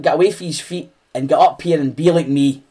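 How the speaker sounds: ordinary talk, nothing marked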